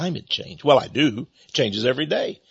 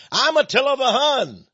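mock pride; 10 semitones higher than usual. Proudly mocking the insult.

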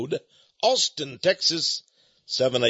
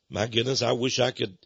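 usual speaking voice

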